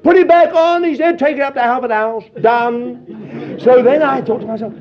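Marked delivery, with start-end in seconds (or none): 0.0-0.8 high, loud order to the workmen; calling out orders. Retold or imitated. Dealer calling out orders, retold. 1.2-3.6 raised-voice instruction to workmen; brisk deal-closing call; calling out orders. Retold or imitated. Dealer calling out orders, retold.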